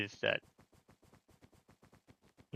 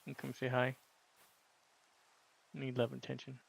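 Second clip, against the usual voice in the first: cooing to a scared pet; 4 semitones higher, 7 dB quieter, faster than usual. Real, not acted. Soothing a pet.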